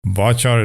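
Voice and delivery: "Varchar" with ch as in chart. Shown as a demonstration. Settling the pronunciation debate, "Varchar" slowly with ch. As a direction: say "Varchar" as var-char, slowly. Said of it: "that's the real way to pronounce it".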